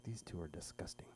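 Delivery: whispered disapproving aside; judgmental whisper. Retold or imitated. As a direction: murmur it behind your hand. Strangers judging quietly.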